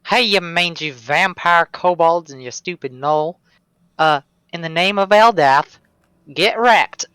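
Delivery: loud, high battle cry at the enemy; yelled taunt. Pretend. Player yelling out at the vampire kobolds and gnoll, loud taunt. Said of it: "I'm going to yell out".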